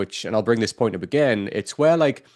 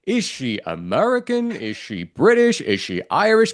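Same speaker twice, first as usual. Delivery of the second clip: high, drawn-out puzzled questions; mock bafflement; 6 semitones higher, slower than usual. Podcaster quizzing the actress's shifting accent, mock bafflement. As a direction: act out baffled disbelief, each question pitched up.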